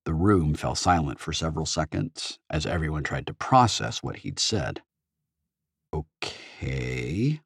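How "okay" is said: drawn-out, hesitant okay; said slowly. A narrator's reading. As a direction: stretch it out, unsure. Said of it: "Artemis said slowly".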